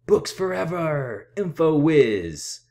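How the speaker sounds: loud, high wrestler shout; luchador battle cry. Shown as a demonstration. Reader showing her Lucha Librarian persona, loud battle cry. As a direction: bellow it like a masked luchador. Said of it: "Here's an example of mine".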